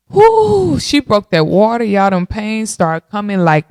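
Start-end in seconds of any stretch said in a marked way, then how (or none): 0.1-0.8 sudden high yelp as the pain hits; high whoop of pain. Retold or imitated. Mom retelling labor, a high yelp of pain. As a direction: let out a high whoo.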